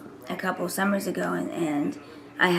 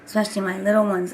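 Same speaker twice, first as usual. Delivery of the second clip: ordinary delivery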